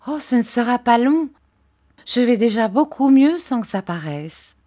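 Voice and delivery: eager to reassure her husband; Dora's voice. Acted. Dora reassures David. (voix de Dora, plus aiguë, rassurante)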